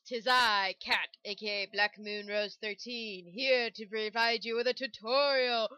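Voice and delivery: slow, grand mock-villain welcome; sneering panto villain. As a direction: gloat like a stage baddie.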